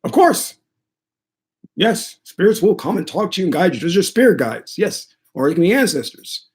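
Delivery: high-pitched priest impression, emphatic at the start; high priest's voice. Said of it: "that's how he talked".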